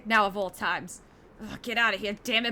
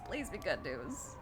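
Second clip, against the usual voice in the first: softer, slower hopeful plea; quiet plea; 6 semitones lower, 11 dB quieter, slower than usual. Real, not acted. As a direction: murmur it like a prayer.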